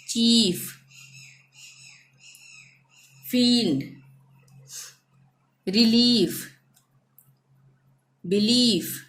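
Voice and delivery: stretched ई in बिलीव, ब्रीफ, चीफ; careful word list, every ई held long; drawn-out ee. Shown as a demonstration. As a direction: hold the ई long in each word, as in शील्ड. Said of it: "हम आईई को हम खींचकर बोलेंगे".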